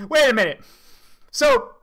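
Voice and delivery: ordinary talk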